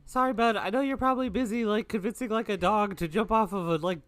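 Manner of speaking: loud, high, rambling chatter; flustered apology. Acted. Player in character addresses Nanoxus.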